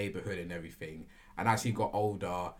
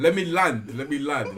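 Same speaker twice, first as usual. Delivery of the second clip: louder, pressing to be heard; insistent; 4 semitones higher, 9 dB louder than usual. Real, not acted. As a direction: cut them off, let me finish. Insists on finishing his story.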